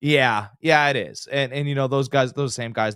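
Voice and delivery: ordinary delivery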